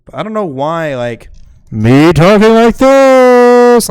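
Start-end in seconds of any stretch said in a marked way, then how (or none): 1.7-3.9 loud, slow, drawn-out and higher; slow loud call; very loud demo. Shown as a demonstration. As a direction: belt it out at full volume so the meter peaks. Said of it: "that should red bar".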